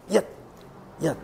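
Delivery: 얏 said very short and clipped; staccato. Shown as a demonstration. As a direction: snap 얏 off abruptly, like a stop. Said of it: "굉장히 짧고 촉박한 음입니다". (얏을 짧고 촉박하게 끊어서)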